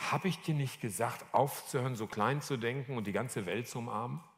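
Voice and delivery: soft, restrained reproach; understated, gentle admonishing; gentle reminder. Retold or imitated. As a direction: quietly remind someone of what they were told. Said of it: "Das war so ein ganz sensibler Moment".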